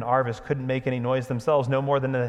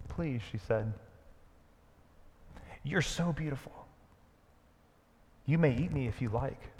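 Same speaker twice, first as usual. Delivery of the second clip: softer, trembling-hearted plea; timid plea; 2 semitones higher, 6 dB quieter than usual. Acted. Mare Hwin pleading softly to the lion, timid. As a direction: offer yourself gently, in awe. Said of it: "shaking all over".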